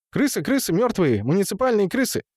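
high, repetitive blurting; high excited babble. A narrator's reading. Темул explains his money to Густад, high excited gabble. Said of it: "высокий голос, бурно тараторивший".

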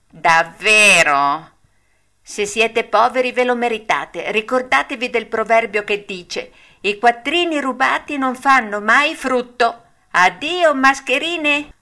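the puppet taunting the swindlers; Pinocchio's voice. A narrator's reading. Pinocchio mocking the fox and cat, high and loud.

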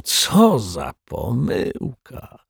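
slow, high mock dismay; theatrical exaggeration. A narrator's reading. Rogue laments his mistake, with theatrical exaggeration. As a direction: lament it like a ham actor. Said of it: "zawołał z teatralną przesadą".

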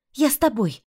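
high desperate cry; shout. A narrator's reading. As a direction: call out to her husband. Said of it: "крикнула я мужу". (отчаянный крик)

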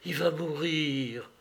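understated fear, slowing and fading; frightened stammer. A narrator's reading. Woman telling the family he is dying, hushed fright. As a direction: let the fear sink softly away. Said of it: "balbutia-t-elle".

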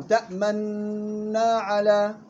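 تأمنا على said very slowly, rounding the lips on the doubled nun; ishmam recitation. Shown as a demonstration. Teacher repeating the recitation for students, slow and melodic. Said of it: "بضم الشفايف إلى الأمام".

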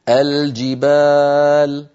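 الجبال drawn out slowly, clear L before the j; izhar. Shown as a demonstration. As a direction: say الجبال as a slow "al-jibaaal". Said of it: "الإظهار سببه بعد المخارج".

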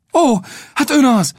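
joyful cry. Acted. Young Will cries out on recognizing his rescuer. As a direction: exclaim with delight. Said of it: "kiáltotta Will".

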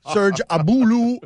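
mock Congolese accent on the name. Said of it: "summon my best Congolese accent".

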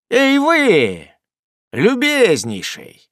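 high calling shout, drawn out. A narrator's reading. Sam calls loudly to the stranger, high drawn-out shout. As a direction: call out to the stranger across the yard. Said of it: "закричал мистер Уэллер".

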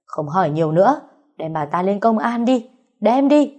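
rising to a loud shout; angry order. Acted. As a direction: order it, ending in a yell. Furious demand to take her away.

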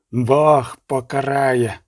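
slow, weighty pronouncement of doom; understated priestly wrath, drawn out; priest's outburst. A narrator's reading. Priest threatening divine punishment, understated outburst. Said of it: "вибухнув Ксьонц".

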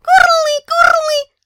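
very high loud joyful creature cries; very high excited dragon squeal; overjoyed dragon shout. Acted. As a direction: shout for joy like a playing dragon. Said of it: "обрадовался он".

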